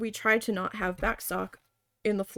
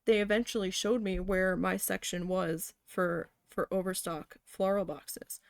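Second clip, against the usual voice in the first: ordinary talk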